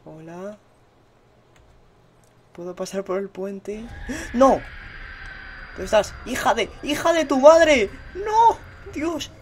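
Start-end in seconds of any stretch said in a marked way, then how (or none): 4.3-7.9 panicked yell. Real, not acted. Panicked yelling at game monster. 8.2-9.3 terrified scream. Real, not acted. Monster catches him, screams.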